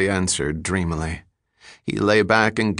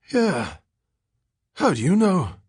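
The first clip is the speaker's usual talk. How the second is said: high-pitched, suspicious ragged drifter; addled derelict, pitched well above the narrator; Billy Collins; 9 semitones higher than usual. A narrator's reading. Ragged man answering a stranger who knows him, high and suspicious.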